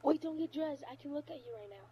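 ordinary talk

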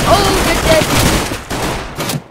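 slow, high, loud gloating; drawn-out threat. Real, not acted. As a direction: stretch it out like a taunt. Threatening enemy.

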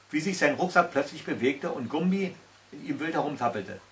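plain reading voice

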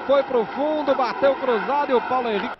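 ordinary talk, nothing marked

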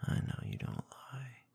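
ordinary delivery, nothing marked

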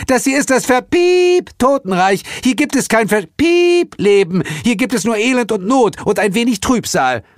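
very high ranting with bleep noises for swearwords; screeching. A narrator's reading. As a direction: rant in an outraged screech. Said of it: "kreischte Hel".

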